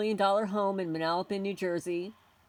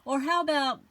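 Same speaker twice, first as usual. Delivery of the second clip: mocking taunt; 6 semitones higher, faster than usual. Real, not acted. Taunting neighbor sarcastically.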